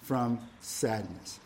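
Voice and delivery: soft, low, slow; softly trailing. Real, not acted. Preacher stating the first point, softly trailing. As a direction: let "sadness" fall softly. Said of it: "I want you to see right here".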